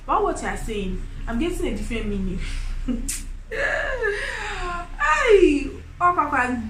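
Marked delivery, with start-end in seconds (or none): 2.4-4.9 high laugh. Real, not acted. Laughing at her own misunderstanding. 5.0-6.6 loud high cry of Hey Papa; loud exclamation. Pretend. Loud mock exclamation in the story.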